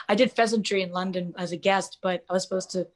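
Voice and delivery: ordinary delivery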